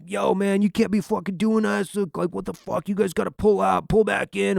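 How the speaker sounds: mock flaming mad. Angry fuel guy telling the gun truck crew off, mock rant. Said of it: "he is flaming mad".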